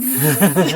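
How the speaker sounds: buzzing like a bee; bee buzz imitation. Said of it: "The bee went like this".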